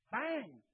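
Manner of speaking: high shouted bang like a knock; sharp bang. Acted. Preacher marking Jesus' knock on the disciples, sharp bang. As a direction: strike it like a knock on a door.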